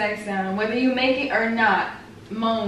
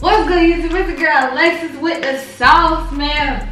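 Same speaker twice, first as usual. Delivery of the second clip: hype intro; 4 semitones higher, 6 dB louder than usual. Retold or imitated. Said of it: "copies his intro".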